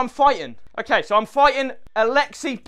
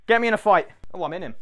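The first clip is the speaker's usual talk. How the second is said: normal talking voice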